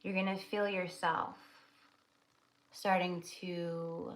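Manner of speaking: soft, steady meditation voice; guided meditation. Acted. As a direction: lead them gently into the vision.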